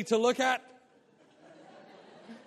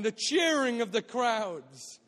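normal talking voice

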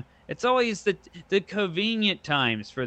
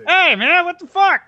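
high, loud jokey shout; mock Saul greeting; 13 semitones higher, 9 dB louder than usual. Friend voices Saul greeting him in heaven, high loud shout. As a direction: play Saul hollering at the gate.

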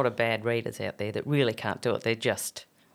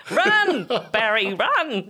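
excited yell; 8 semitones higher, 5 dB louder, much slower than usual. Pretend. Joking shout to Humphrey.

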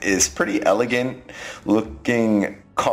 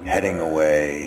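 ordinary talk

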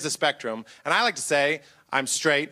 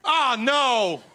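mock sympathy; 3 semitones higher, slower than usual. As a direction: ham up fake condolence. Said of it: "I'm just like".

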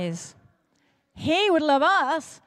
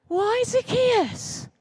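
indignant crowd; 8 semitones higher, much slower than usual. Acted. Crowd gasps, asks.

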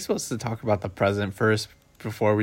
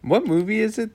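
ordinary delivery

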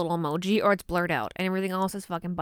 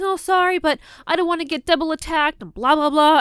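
high, louder whiny mock apology; mocking friend; 7 semitones higher, 6 dB louder than usual. Mocking her best friend's excuse, in a high whiny voice. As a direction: mimic the friend's excuse, pitched way up. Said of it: "and then she's saying".

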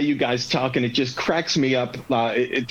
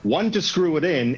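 ordinary delivery

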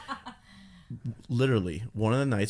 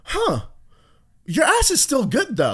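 put-on girl voice; 13 semitones higher, 5 dB louder than usual. Retold girl, high voice.

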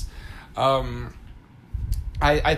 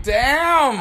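impressed exclamation; 10 semitones higher, 5 dB louder than usual. Retold or imitated. Reacting to the movie line.

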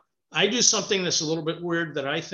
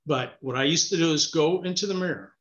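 ordinary talk, nothing marked

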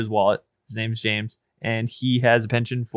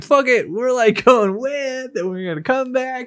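mock careless co-host; 12 semitones higher than usual. Host teasing Brian's approach, high mocking imitation. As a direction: send him up in a high voice.

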